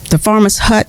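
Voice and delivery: plain reading voice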